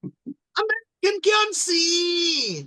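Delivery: very high, slow, sustained cry; high drawn-out wail. Acted. Co-host quoting the film for laughs, high hammed-up wail. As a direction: stretch each phrase into a long high wail.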